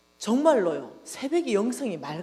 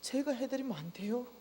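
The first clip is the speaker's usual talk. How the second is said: inner plea; 3 semitones higher, 7 dB quieter than usual. Retold or imitated. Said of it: "내가 속으로 그냥 이랬어요".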